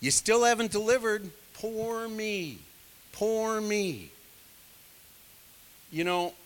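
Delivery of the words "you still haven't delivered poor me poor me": higher, mock self-pity; hammed-up poor me moan; self-pitying whine. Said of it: "That's kinda what he's saying here".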